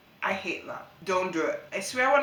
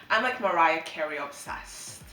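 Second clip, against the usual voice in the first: ordinary talk, nothing marked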